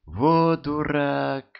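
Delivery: put-on high, slow girlish exclamation; girlish scolding. Girlfriend scolds him for his charity, high and girlish. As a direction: tease him, high and drawn out.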